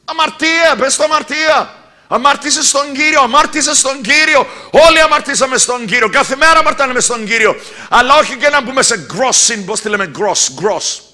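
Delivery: high, loud, pounding sermon; fiery preaching. Acted. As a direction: preach it hard to the congregation. Preacher at full force. (φλογερό κήρυγμα)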